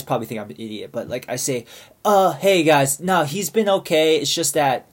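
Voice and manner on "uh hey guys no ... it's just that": louder, higher retold plea to the group; imitated appeasing interjection, easing down toward the end; trying to placate. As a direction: call out and smooth things over. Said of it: "trying to, like, calm the situation".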